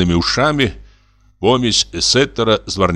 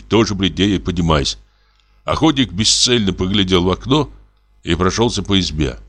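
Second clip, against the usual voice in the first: ordinary delivery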